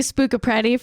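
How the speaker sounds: normal talking voice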